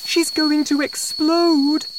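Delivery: very high, loud wail; high-pitched, alarmed shout; panicked cry. A narrator's reading. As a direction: cry out in terror as the daughter. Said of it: "Missus Cranky cried".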